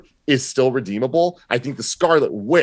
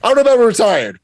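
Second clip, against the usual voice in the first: louder, much higher I don't know; exasperated outburst; 11 semitones higher, 8 dB louder, faster than usual. Real, not acted. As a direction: throw up your hands, half laughing. Laughing exasperated reaction.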